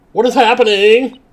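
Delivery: very high, loud, slow; bewildered outburst. Real, not acted. Baffled by the scene, high loud bewildered cry. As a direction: stretch the disbelief.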